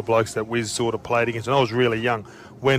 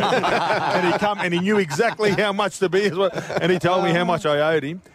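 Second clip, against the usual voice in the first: high, amused telling broken by laughter; laughing while talking; 7 semitones higher than usual. Real, not acted. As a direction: tell it through laughter, pitched up. Laughing as he tells punchline.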